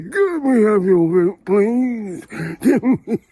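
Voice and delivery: high, mock-polite doorstep plea; minion voice. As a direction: play a simpering minion begging for votes. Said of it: "they knock on doors and they say".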